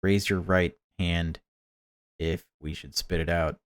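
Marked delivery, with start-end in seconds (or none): none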